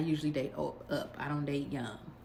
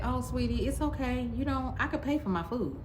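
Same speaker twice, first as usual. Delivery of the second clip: sugary, higher "oh, sweetie" with a bite; mock sweet condescension; 9 semitones higher than usual. Telling the date who rushed to pay that she can cover her own food, mock sweet. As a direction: talk down to him sweetly.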